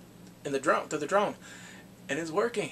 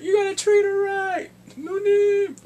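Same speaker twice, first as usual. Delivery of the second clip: much higher, much louder bellow; loud gang underling; 11 dB louder, slower than usual. Retold or imitated. Underling warning the boyfriend, loud high bellow. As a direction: bark it out like an eager gang lackey. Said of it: "He's like".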